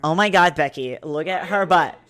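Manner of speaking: put-on loud ditzy Valley Girl gush. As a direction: gasp it like a shocked mall girl. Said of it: "this is a Valley Girl voice".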